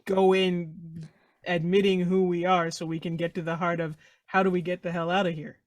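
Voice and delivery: usual speaking voice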